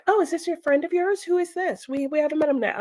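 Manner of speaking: lightly voiced parents. Parents greeting guest.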